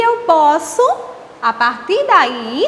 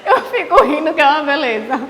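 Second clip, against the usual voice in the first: laughing through the words. Real, not acted. Teacher joking to the class about her falls, laughing. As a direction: giggle while telling it. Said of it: "eu começo a rir".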